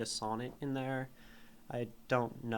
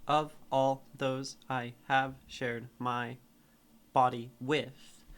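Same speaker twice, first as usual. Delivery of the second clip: slow, each word tapped out; syllable by syllable; 4 dB louder, slower than usual. Shown as a demonstration. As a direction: count out the one-syllable words slowly. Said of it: "eight of those words are one syllable".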